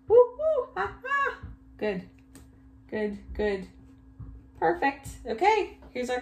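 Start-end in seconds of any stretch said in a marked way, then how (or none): 0.1-1.4 loud, very high monkey hoots; monkey call. Pretend. Teacher reading along with children, monkey call. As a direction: whoop it out like an excited monkey. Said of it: "I wanna do a monkey voice".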